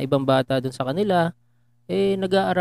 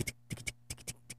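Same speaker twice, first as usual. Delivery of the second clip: horse hoof clicks; 24 dB quieter, faster than usual. Retold or imitated. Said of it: "sound effects pa ng kabayo".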